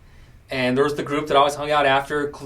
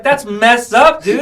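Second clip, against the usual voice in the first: indignant; 11 semitones higher, 11 dB louder, slower than usual. Retold or imitated. Indignant at coworkers' prejudice.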